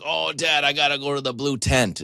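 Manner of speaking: put-on high, whining child faking sickness; whiny kid voice. Son to dad, whining to escape the game. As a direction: play the son angling to get out of ping pong.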